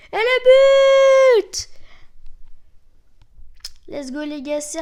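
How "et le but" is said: long, high, very loud goal cry; goal celebration shout. Real, not acted. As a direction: belt out the goal, stretching it like a commentator. Scores a goal in game. (cri de but, long, aigu et très fort)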